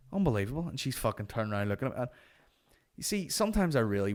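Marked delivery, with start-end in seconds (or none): none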